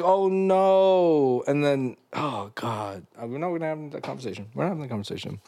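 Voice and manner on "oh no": mock wail. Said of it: "I started crying".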